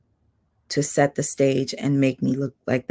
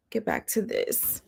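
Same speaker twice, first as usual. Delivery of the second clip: much softer, to herself; quiet aside; 5 semitones higher, 8 dB quieter than usual. Real, not acted. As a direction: mumble it under your breath. Repeating to herself.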